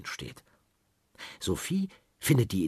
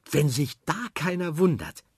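put-on pleased girl; 7 semitones higher than usual. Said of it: "sagt sie".

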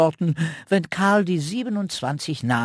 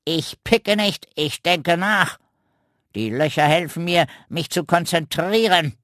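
huffy raven; 5 semitones higher, 3 dB louder than usual. Acted. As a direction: lecture like a pompous old raven. Raven character voice.

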